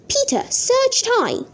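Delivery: high pitched on high; searched high. A narrator's reading. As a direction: voice jumps high on high. Wordplay high and low.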